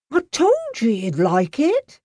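put-on excited young footman; cheerful exclamation. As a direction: crow happily at the cook. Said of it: "Wiggins the footman exclaimed".

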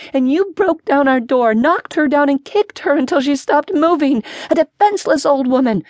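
high-pitched, outraged accusation; shriek. A narrator's reading. Older sister tells how grandmother was beaten, high indignant shriek. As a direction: keep it high and indignant throughout. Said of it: "shrieked the older sister".

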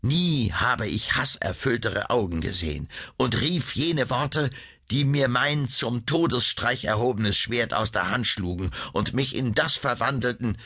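usual speaking voice

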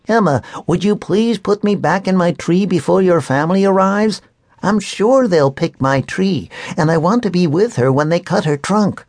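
high, earnest little elf voice; a small tree spirit asking a favour; tiny magical man. A narrator's reading. Tiny man asking Emma to put him back, high and earnest.

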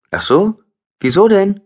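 put-on high, loud surprised teenage girl; a startled girl asks why, voice jumping high; young girl, surprised. A narrator's reading. Jola, caught off guard, asks the doctor why in a high girl's voice.

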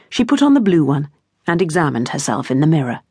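ordinary delivery, nothing marked

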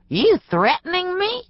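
high, tense snarl; old storekeeper snarling a challenge; snarled line. A narrator's reading. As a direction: snarl it at him as the old hillbilly. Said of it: "he snarled then".

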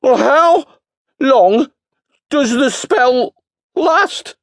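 very high, loud, drawn-out words; Bingo shouting slowly. A narrator's reading. Bingo to the deaf wizard, slow loud shout. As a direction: spell it out to a deaf man.

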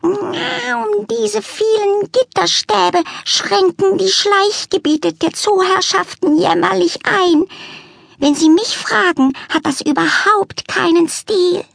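high, prim, put-upon lament; complaining cat lady. A narrator's reading. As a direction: sniff at the disappointing zoo.

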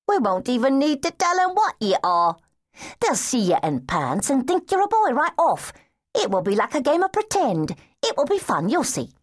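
put-on playful street girl. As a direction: sell it like a fun game.

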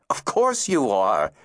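slow, emphatic declaration; vociferously. A narrator's reading. Zist reassuring the crying boy, emphatic and slow. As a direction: insist on it, firmly and slowly. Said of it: "Zist declared vociferously".

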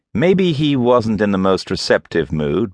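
usual speaking voice

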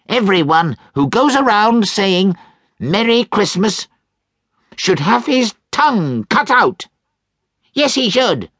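put-on angry old miser. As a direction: build the rant against Christmas. Scrooge ranting.

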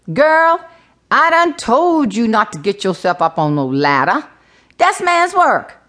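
high, scolding Gullah exclamation; Gullah brogue. Acted. Tootsie scolds the child who fell off a ladder, Gullah brogue. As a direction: give a good tongue lashing in a Gullah brogue. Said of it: "exclaimed Tootsie in her gullah brogue".

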